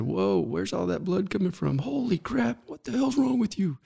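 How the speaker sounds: high, startled yelp turning into an angry outburst; imitating an angry coworker. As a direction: play the shocked, annoyed boss seeing blood. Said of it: "He was pissed at first".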